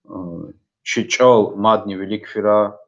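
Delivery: usual speaking voice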